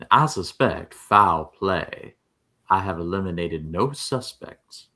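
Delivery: slow, put-on Southern detective accent; Daniel Craig's Knives Out accent. Quoting a film detective for comparison, Southern accent. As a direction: imitate a Southern movie sleuth's accent. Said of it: "Daniel's Craig's Knives Out accent".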